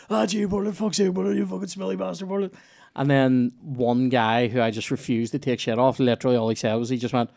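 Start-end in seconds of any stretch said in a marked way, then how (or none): 0.1-2.5 higher jeering schoolboy taunt; mocking mates. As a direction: jeer at him like a schoolmate. Mates mocking him in chorus.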